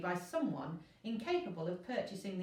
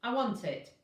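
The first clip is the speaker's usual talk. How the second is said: loud curt demand; rude demand; 4 semitones higher, 6 dB louder than usual. A narrator's reading. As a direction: insist on it rudely. Rude inspector demands ticket.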